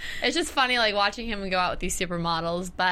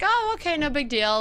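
breezy high-pitched "oh", then easing down; retold nonchalance; 7 semitones higher than usual. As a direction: imitate someone totally unbothered. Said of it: "he didn't even seem, Marcus didn't seem, like, too".

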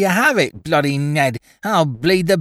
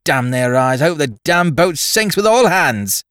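high, louder bratty oaths; angry cursing boy; 7 semitones higher, 4 dB louder than usual. Acted. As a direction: rant like a spiteful ship's boy. Boy's cursing rant continues.

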